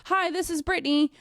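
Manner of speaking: bright high "Hi" up top; chirpy sales greeting. Shown as a demonstration. Trainer demoing the greeting, high chirpy "Hi". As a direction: sound like a perky cold call. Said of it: "we teach them how to speak like this".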